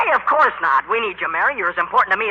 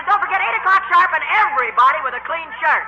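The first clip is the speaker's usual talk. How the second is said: raised voice across the stage; calling the band; 9 semitones higher than usual. Acted. Bandleader shouts orders to his band after rehearsal. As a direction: call out instructions to the band.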